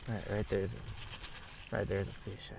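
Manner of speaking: slow low hushed voice; hush, don't spook the fish. Real, not acted. Hushed while spotting fish.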